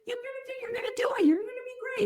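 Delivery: high, fast breezy whisper; mock the partner's airy optimism; light mocking whisper of a partner's cheer; imitating partner. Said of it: "He's like".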